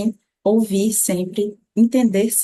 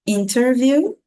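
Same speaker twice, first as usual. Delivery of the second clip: normal talking voice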